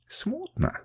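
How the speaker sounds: a high, soft, hesitant word; hesitant mumble. A narrator's reading. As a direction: mumble it, unsure, drifting up high. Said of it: "колебаясь, пробормотал Камчак". (неуверенно, тихо и высоко пробормотать)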